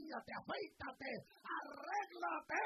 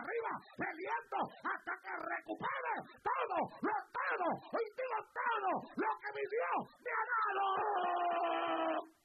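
high, loud, slow shout breaking into a cry; 6 semitones higher, 12 dB louder, slower than usual. Real, not acted. Preacher vowing to recover everything, tearful shout. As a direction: shout it with tears.

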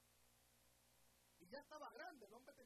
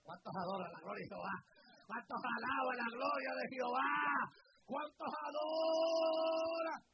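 rising, ever louder pulpit cry; rallying preacher's shout; 18 dB louder than usual. Real, not acted. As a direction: build from loud call to drawn-out roar. Preacher rallies congregation, louder each time.

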